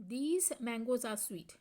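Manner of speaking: very slow, each word spaced out; slow dictation. Shown as a demonstration. Teacher reading the example sentence aloud to students, very slow. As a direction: spell it out for the class.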